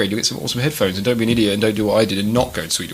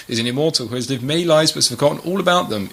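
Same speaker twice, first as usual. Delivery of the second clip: ordinary talk, nothing marked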